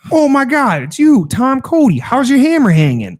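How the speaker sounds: high, excited mock bartender voice; bartender greeting. Bartender greeting his old friend, high and excited. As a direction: squeal it like a delighted old buddy. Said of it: "he's like".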